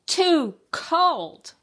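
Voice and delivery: high, loud, stretched whine; exaggerated complaint. A narrator's reading. Child at supper with the peas, exaggerated complaint. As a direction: lean hard on both words.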